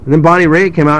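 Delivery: ordinary delivery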